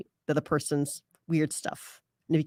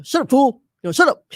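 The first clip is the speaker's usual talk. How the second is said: loud, blunt "shut up, fool"; louder mock-harsh put-down; harsh mock insult; 5 semitones higher, 8 dB louder than usual. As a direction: bark it like a rude brother. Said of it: "They're very harsh".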